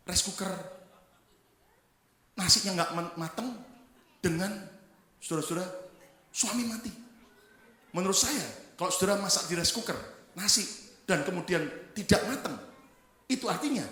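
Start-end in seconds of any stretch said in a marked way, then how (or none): none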